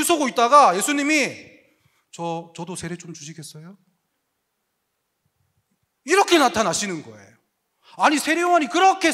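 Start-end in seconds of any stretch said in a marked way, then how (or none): 2.1-3.8 softer, hesitant asking; Jesus meekly waiting in line to ask; timid humble request. Retold or imitated. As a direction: shyly ask to be baptized.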